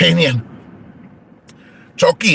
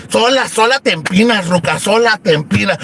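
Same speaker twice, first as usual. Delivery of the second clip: ordinary talk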